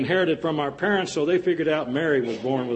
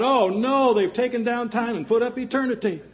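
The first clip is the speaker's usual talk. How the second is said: hammed-up shout; 7 semitones higher than usual. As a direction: cry it out in dismay. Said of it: "And she shouted out".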